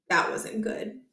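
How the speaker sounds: hushed, breathy aside; quiet aside under the breath; whisper. A narrator's reading. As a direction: whisper it like a worried thought. Narrator's worried aside.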